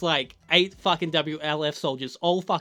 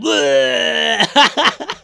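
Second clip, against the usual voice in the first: loud, high cackle of laughter; big laugh; 7 semitones higher, 11 dB louder than usual. Real, not acted. Reviewer cracking up, picturing throwing up. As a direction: crack up at your own joke.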